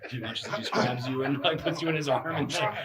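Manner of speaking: narrating through laughter; giggling all through the lines; laughing. Real, not acted.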